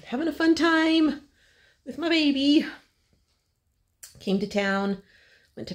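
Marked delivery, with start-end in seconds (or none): 0.1-2.7 high, sweet cooing voice. Real, not acted. Cooing about baby.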